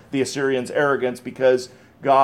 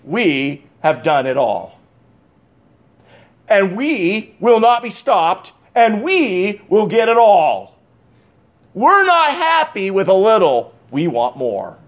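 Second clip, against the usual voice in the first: boastful king; 4 semitones higher, slower than usual. Retold or imitated. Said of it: "The Assyrians said".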